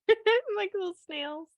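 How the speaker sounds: high little snail voice. Pretend. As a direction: squeak it like a tiny snail. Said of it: "I talk to her like that in that voice".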